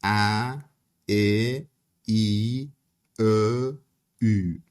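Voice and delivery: each front vowel said alone. Shown as a demonstration.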